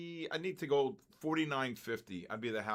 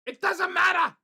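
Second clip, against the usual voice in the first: much higher and louder, shouted out; 13 dB louder than usual. Real, not acted. Trader yelling at his screen, high and loud. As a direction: blurt it out in a squealing yell.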